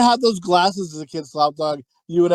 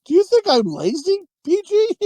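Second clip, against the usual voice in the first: whiny plea; 12 semitones higher than usual. Retold or imitated. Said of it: "he's like".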